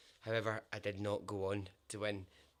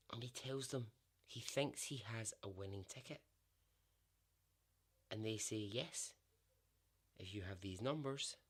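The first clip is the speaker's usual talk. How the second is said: story narration; 3 semitones lower, 6 dB quieter than usual. Acted. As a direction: draw the listener into Bud's big moment.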